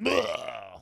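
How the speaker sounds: mock retching bleh; vomiting noise. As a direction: fake a throw-up sound. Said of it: "make it sound like he threw up".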